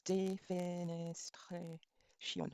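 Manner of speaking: ordinary talk, nothing marked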